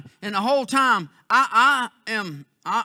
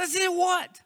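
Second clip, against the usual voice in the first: puzzled retort; 7 semitones higher, slower than usual. Retold or imitated.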